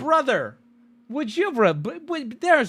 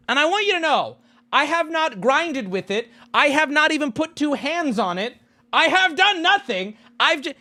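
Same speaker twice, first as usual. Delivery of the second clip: indignant; 9 semitones higher than usual. Real, not acted. Insisting he did nothing.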